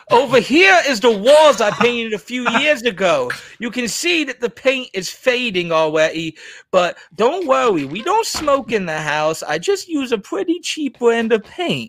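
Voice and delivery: high, slow, put-on tour-guide voice; Bowser mansplaining. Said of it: "imagine him walking around the room".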